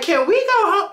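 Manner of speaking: high, small acted plea; imitating a hopeful plea; pleading question. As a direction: ask it meekly, pitched right up. Retold plea to police officer.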